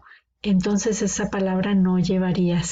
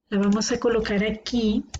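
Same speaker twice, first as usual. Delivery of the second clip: usual speaking voice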